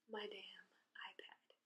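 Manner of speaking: hushed bitter whisper; whispered under her breath. Real, not acted. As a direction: drop to a whisper on the last words. Bitter aside about stolen iPad.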